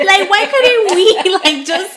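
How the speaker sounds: loud, high, drawn-out and laughing; worked-up exclaiming. Real, not acted. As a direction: exclaim it in amused outrage. Worked up about catfish episode, laughing.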